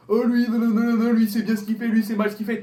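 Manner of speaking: loud, high mocking voice; mocking critic. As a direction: mimic a preachy critic. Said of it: "et disant".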